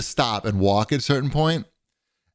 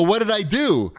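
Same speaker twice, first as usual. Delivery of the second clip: bewildered; 10 semitones higher than usual. Retold or imitated. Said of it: "and I go".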